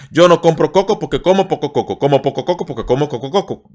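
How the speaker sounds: fast, tumbling syllables; tongue twister speeding up. Shown as a demonstration. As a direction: push the speed. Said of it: "Need to be faster".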